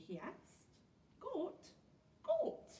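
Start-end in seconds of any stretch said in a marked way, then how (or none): 1.2-1.6 high-pitched puzzled echo; surprised question. A narrator's reading. Pirate chief reacting to goat stew, surprised. 2.2-2.7 very high, loud squawked question; incredulous outburst. A narrator's reading. Pirate chief appalled by goat stew, incredulous.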